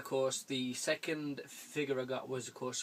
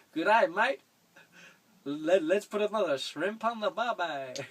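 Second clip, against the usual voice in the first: put-on loud, high, hammy Australian accent; a shamelessly bad Aussie accent; bad Australian impression; 6 semitones higher, 8 dB louder than usual. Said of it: "That was a shit-ass impression".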